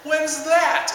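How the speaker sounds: put-on high, small child voice; kid voice. Uncle imitating the four-year-old's innocent question in a high child voice. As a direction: ask it like a puzzled little kid.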